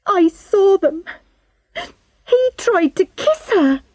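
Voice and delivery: put-on high-pitched girl's upset blurt; tearful, angry outburst. A narrator's reading. Crying Charlotte suddenly revealing what she saw, angrily tearful. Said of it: "started to cry quietly, angrily".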